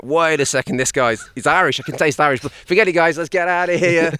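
high, loud, squeaky mosquito chatter; mosquito gang voice. Pretend. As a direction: play a fussy bug tasting blood.

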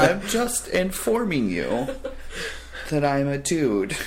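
slow, high and laughing. Retold or imitated. Telling the employee he is a dude, slow and laughing. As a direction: spell it out through laughter.

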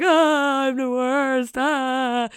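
high anguished "ah, I'm the worst"; despairing wail. Retold or imitated. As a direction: wail it out like a sulking twin. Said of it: "in a way of like".

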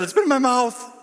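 high, loud, desperate outburst; blurted confession. Retold or imitated. Preacher confesses to lead pastor, loud and desperate. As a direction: blurt it out in exasperation.

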